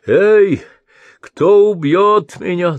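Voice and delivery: a high, loud cry like an owl, slow "кто"; hooting shout. Acted. Blind old man calling into the night, owl-like shout. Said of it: "заухал, закричал, как филин".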